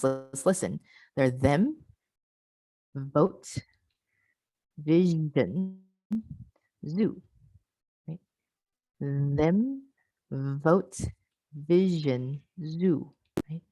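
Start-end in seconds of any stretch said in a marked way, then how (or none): 1.1-7.2 careful, drawn-out voiced consonants; voiced fricatives demo. Shown as a demonstration. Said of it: "So if we listen to these, right, the voiced ones". 9.0-13.1 careful, drawn-out voiced consonants; voiced fricatives demo. Shown as a demonstration. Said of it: "the voiced ones".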